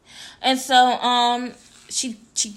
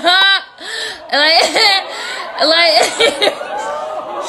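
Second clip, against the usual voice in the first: loud, high mock laughing; imitated crowd laughter; 8 semitones higher, 8 dB louder, much slower than usual. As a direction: burst out like the laughing onlookers. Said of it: "everybody started laughing like".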